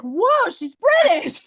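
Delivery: loud, drawn-out astonishment; amazed reaction. Retold or imitated. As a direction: act out being blown away. Acting out own amazed reaction.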